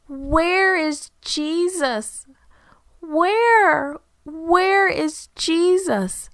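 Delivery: high, slow, keening cry of grief; wailing. Acted. Mary wailing for her dead brother, high slow keening. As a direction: wail it out like a grieving sister. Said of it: "Mary wailed".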